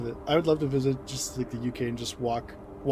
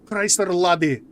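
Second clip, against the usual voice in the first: loud Scottish exclamation, higher; Scottish accent; 5 semitones higher, 10 dB louder, much slower than usual. Retold or imitated. Mocking how a Scottish man speaks, in a loud Scottish accent. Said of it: "hearing a Scottish man speak".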